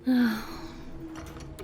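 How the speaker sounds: long, soft exhaled sigh; airy sigh of giving in; reluctant sigh. Acted. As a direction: breathe out slowly in resignation. Reluctantly accepting an apology.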